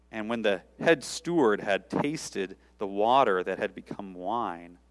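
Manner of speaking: usual speaking voice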